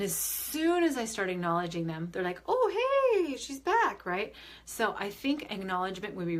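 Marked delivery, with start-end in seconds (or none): 2.5-4.0 high, bright cry of welcome; squealing hello from the spirits; excited spirit greeting. Retold or imitated. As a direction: greet her like an old friend who just walked in. Spirits greeting her return.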